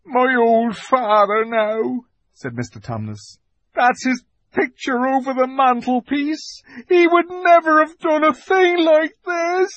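a son shamed before his father; ashamed fawn. Acted. The fawn speaks of his father to Lucy, ashamed.

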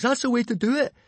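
high, loud encouraging shout; excited high-pitched cheer. A narrator's reading. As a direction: cheer him on from the sidelines. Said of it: "cheered Fishlegs".

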